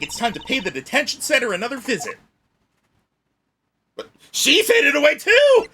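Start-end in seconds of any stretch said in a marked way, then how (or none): 3.9-5.7 high, loud shouting alarm; dramatic alarm. Real, not acted. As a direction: cry out that he's left alone. Mock alarm at vanishing character.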